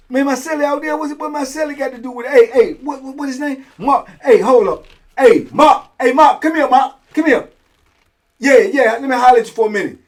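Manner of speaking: loud, high call to a cellmate across the cell block. Retold or imitated. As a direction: shout for the cellmate to come over. Calling cellmate Mark across the tier.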